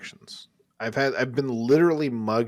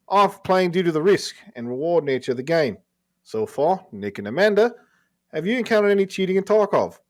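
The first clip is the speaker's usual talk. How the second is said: raised, loud Australian read-aloud; Australian accent; 5 semitones higher, 6 dB louder than usual. Acted. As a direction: play the Aussie listener writing in. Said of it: "that might be your best accent".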